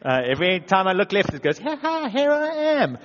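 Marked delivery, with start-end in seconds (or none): 1.6-3.0 high mocking voice of his insecurity; taunting inner voice. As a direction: lightly play a teasing little gremlin popping up.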